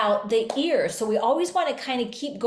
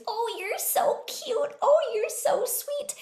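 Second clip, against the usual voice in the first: put-on much higher, slow cooing baby talk; cute baby talk; 13 semitones higher, slower than usual. Shown as a demonstration. Parent talking to a baby, high slow cooing. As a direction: coo at a baby in a high little voice. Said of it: "that cute little high voice".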